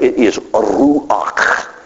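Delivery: ruach drawn out slowly on breath; breathy ruach. Shown as a demonstration. Preacher voicing God's breath for congregation. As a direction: let ruach push out like wind. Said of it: "God squeezing himself through you".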